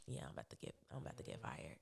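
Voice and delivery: low, very soft aside to oneself; resigned murmur. Retold or imitated. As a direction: murmur it like private, resigned knowledge. Retelling resigned inner realisation before firing.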